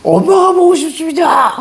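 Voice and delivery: very high, hammed-up tearful cry; recruit's formal reply turned into a bawl; mock homesick wail. Crying recruit tells instructor he misses mum, mock wailing. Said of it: "갑자기 애들이 한 애가".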